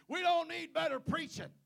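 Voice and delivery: loud, high preacher's burst on DON'T; preaching. Shown as a demonstration. Preacher announcing he'll preach to the church, loud. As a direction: hit "don't" like a pulpit shout. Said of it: "Now I'm gonna preach".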